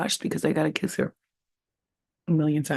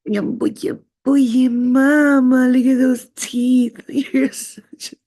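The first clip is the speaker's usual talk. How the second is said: slow, louder, higher doting coo; 6 semitones higher, 6 dB louder, slower than usual. Real, not acted. Owner gushes at her pet, slow loud baby talk. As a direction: drawl it out like she's the cutest thing.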